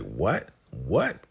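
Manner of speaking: acted-out incredulous "what?", pitch swooping up; shocked disbelief. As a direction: play her stunned reaction, each one a sharp rising question. She reacts to shocking news.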